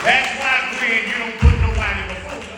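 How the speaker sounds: loud, rising sermon cadence; preaching. Acted. Preacher to congregation, full-force preaching.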